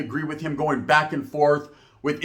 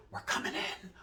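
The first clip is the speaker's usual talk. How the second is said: whisper; 17 dB quieter than usual. Retold or imitated. Retold raid, bursting in.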